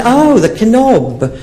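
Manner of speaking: high, drawn-out lady's "oh"; slow dawning realisation. Retold or imitated. Woman finally getting it, high and very slow. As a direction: stretch the oh like the penny drops.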